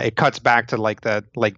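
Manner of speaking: usual speaking voice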